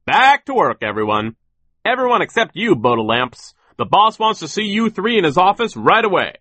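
very high, loud bellow; shouted orders. A narrator's reading. Foreman sends workers back and summons children, shouting. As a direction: holler commands over the whole crew. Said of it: "he shouted".

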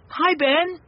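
put-on high boy's call; hailing a pal in a high child's voice. A narrator's reading. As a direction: call to a friend, pitched high. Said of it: "called a voice".

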